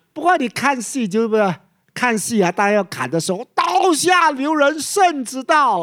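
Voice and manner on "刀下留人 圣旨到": drawn-out, slow, high shout; slow, stretched stage cry, like in the theatre; opera-style call. Shown as a demonstration. (像看戏一样拉长声音高喊)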